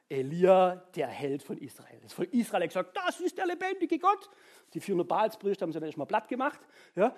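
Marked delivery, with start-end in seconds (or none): 3.0-4.3 awed crowd proclaiming. Acted. People of Israel proclaiming.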